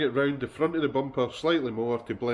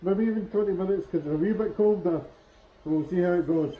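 muffled behind a respirator mask; through a respirator mask; 6 semitones higher than usual. Real, not acted. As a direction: talk through a respirator mask, raised higher. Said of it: "hear me properly through the respirator".

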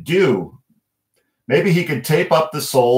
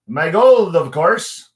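Irish leprechaun; 6 semitones higher, 5 dB louder, slower than usual. Acted. Leprechaun character voice.

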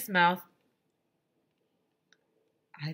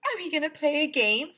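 higher hopeful question; hopeful Andrea; 9 semitones higher, 4 dB louder than usual. Acted. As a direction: ask the principal hopefully. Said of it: "asked Andrea hopefully".

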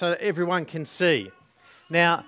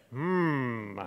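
low soft pondering hmm; thoughtful hmm; 8 semitones lower, 10 dB quieter than usual. Shown as a demonstration. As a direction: hum like a wise man pondering. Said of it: "you say".

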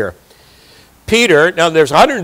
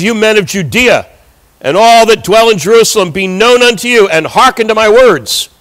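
higher, mildly raised proclaiming voice; 4 semitones higher, 3 dB louder than usual. Acted. Peter addressing the men of Judea, voice lifted in proclamation. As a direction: lift the voice to address a crowd. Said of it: "He lifts his voice and says".

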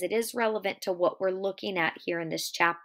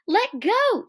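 high, loud demand; sharp high-pitched yell; alarmed cry; 13 semitones higher, 7 dB louder than usual. Acted. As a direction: cry out to be released. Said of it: "she cried".

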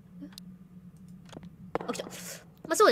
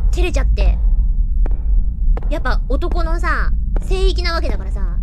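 higher and louder, gleeful teasing; excited loud taunting; 4 semitones higher, 7 dB louder than usual. Real, not acted. Streamer taunting the ghost from the men's toilet, loud gleeful teasing. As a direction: crow at the ghost who can't follow.

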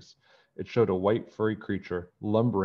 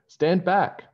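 much higher and louder stand back; warning shout; 11 semitones higher, 7 dB louder than usual. Acted. George warning friends before throwing, shouting. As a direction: shout a warning to clear space. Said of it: "she said".

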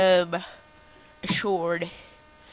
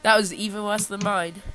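usual speaking voice

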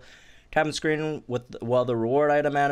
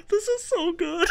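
laughing delight. Real, not acted. Overjoyed at update.

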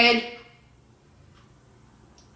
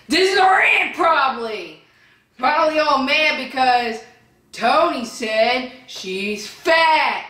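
angry rant; 7 semitones higher than usual. Real, not acted. Ranting at aunt in comments.

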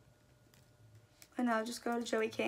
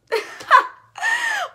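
high, loud burst of laughter; shrieking laugh; 11 dB louder than usual. Real, not acted. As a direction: cackle at your own joke. Laughs at own pitch.

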